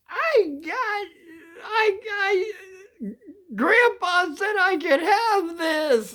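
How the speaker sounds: very high, drawn-out squeal of excitement; squeaky mock boasting, stretched out; excited kid brother. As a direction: imitate a thrilled child in a sky-high voice. Voicing excited Bro running up.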